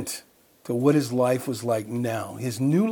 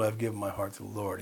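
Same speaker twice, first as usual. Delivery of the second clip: confession; 6 semitones lower, 11 dB quieter, faster than usual. Retold or imitated. Confessing faith to former prisoner.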